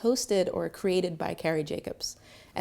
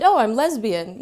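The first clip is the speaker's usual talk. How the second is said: proud; 3 semitones higher, 10 dB louder than usual. Retold or imitated. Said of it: "feel pride in it".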